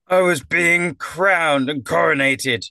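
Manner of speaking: aggrieved clown's protest; angry, disgruntled. Acted. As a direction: wail the grievance, drawing it out. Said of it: "a rather angry, disgruntled voice".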